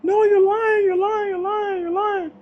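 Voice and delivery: very high, wailing cries of disbelief; retold anguished screams. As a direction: imitate the sister screaming no in grief. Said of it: "hearing cries and screams from my sister".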